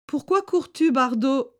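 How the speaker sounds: a villager catching him running; Mesto's call. A narrator's reading.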